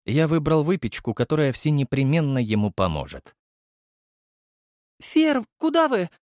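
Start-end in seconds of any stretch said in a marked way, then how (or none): 5.0-6.1 put-on high girlish call after him. A narrator's reading. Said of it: "позвала меня Настя". (высокий девичий оклик)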